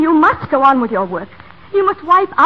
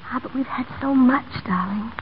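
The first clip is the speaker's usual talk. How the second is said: breathy whisper of a fading wife; soft whisper; 6 dB quieter than usual. Acted. Dying wife to her husband at the end, whispered. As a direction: let it out as a barely voiced whisper.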